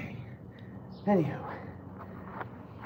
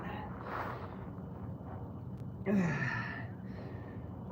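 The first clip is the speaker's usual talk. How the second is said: long unvoiced tired breath out; heavy sigh; 2 semitones higher, 12 dB quieter than usual. Real, not acted. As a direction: let out a spent sigh. Heavy breath during workout.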